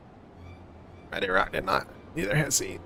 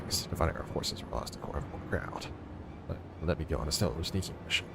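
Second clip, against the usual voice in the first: whisper; 8 semitones lower, 10 dB quieter than usual. Pretend. Stealth sneaking mission.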